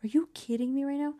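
ordinary talk

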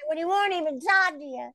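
very high, loud exasperated character voice; ranting puppet. Acted. Ginger rants at her improv partner, very high and exasperated. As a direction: rant in a frantic high puppet voice. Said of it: "she just goes on this rant".

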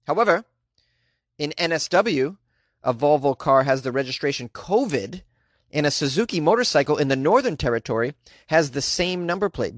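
ordinary delivery

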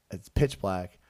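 normal talking voice

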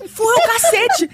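high, loud, laughing repeat of dad's line; laughing imitation. Retelling dad's line to friends, laughing loud. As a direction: relive it through laughter.

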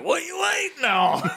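high-pitched mock-exasperated holler; hammed-up impatient demand; impatient retelling. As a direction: imitate a lock keeper hurrying boaters along. Impatient lock keeper imitated.

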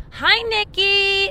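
high, slow, sing-song hello; barista's chirpy greeting. Retold or imitated. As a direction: stretch it out like a cheery barista calling a regular. Barista greeting her by name.

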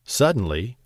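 plain reading voice

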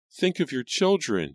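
high-pitched desperate plea; crying out. A narrator's reading. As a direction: cry out high on the mother's behalf. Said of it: "Jane cried".

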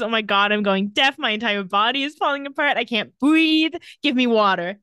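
mock panic. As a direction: climb into a breathless fake freak-out. [name] panicking, body failing.

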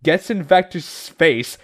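ordinary talk, nothing marked

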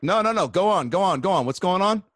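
high-pitched mock eagerness; insistent urging. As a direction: wave off the objection and eagerly egg them on. Retelling himself urging friend on.

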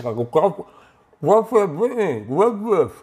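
muffled, garbled thanks around an object in the mouth; barely intelligible mouth-full mumbling. Acted. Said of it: "no puede decir gracias muy bien porque tiene el arma en la boca".

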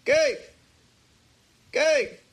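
calling out over a dropped call; bad connection call. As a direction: fake lagging phone call. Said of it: "You pretend you're frozen?".

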